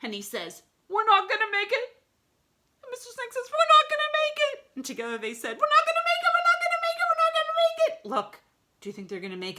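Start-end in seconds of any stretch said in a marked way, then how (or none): 0.9-2.0 high, loud squeal of alarm; panicked character. A narrator's reading. Flying toward the window, high-pitched panic. 3.5-4.7 very high, fast, loud squeal; panicked snake. A narrator's reading. Mister Snake flying at the window, frantic squeal. 5.6-8.1 very high, loud repeated squealing; group panic. A narrator's reading. All of them flying at the window, frantic squealing.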